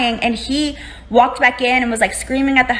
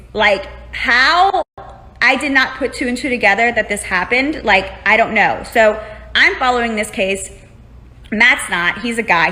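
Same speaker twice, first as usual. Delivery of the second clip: ordinary delivery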